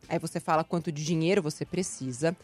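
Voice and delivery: usual speaking voice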